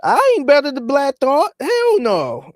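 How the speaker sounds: high, eager voice swearing he's not better; mock humble rapper. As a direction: play a rapper putting himself down, squeaky and earnest. Mocking rapper tucking his tail.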